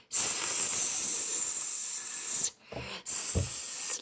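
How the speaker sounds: long unvoiced hiss on S, like a snake; drawn-out s like a snake. Shown as a demonstration. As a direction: hiss the S out long like a leaking balloon. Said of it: "The letter S sounds like this".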